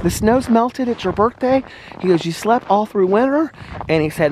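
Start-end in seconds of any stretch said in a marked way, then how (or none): 0.0-1.6 high-pitched playful imitation; Alex being silly. Retelling a morning birthday joke, silly high voice. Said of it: "He was being so silly". 2.2-3.5 high, playful teasing imitation; mock-announce it, pitched up; Alex being silly. Retelling a joke about sleeping through winter, silly high voice. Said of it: "He was being so silly".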